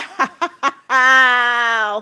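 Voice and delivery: long, drawn-out laugh; amazed laugh. Real, not acted. Amazed by all of Alex's gifts, long laugh. Amazed after the gift flood.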